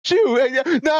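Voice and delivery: usual speaking voice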